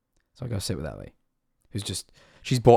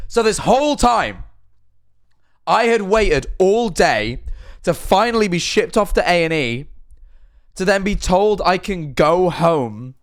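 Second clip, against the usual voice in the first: exasperated rant; 7 semitones higher, 4 dB louder than usual. Real, not acted. Exasperated climax of the story.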